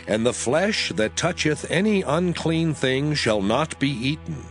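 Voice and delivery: ordinary delivery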